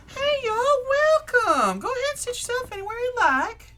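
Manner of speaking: very high, loud, quick greeting; friendly diner hostess. Acted. Middle-aged hostess welcoming diners, high cheery voice. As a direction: chirp it at arriving customers. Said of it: "She goes".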